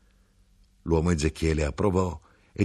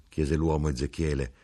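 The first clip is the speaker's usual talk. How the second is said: plain reading voice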